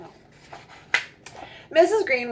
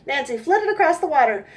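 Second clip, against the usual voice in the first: ordinary delivery, nothing marked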